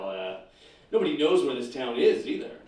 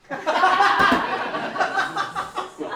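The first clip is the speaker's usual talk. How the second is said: high villain giggle; 6 dB louder than usual. Acted. Giggle in supervillain voice.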